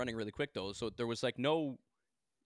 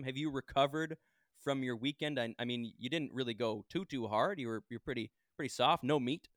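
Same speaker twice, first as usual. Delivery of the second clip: ordinary talk, nothing marked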